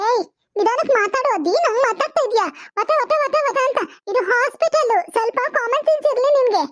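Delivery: high-pitched, swooping telling-off; acted, high-pitched exasperation; indignant scolding. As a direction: scold the noisy relatives in a hospital ward. Scolding noisy relatives in hospital.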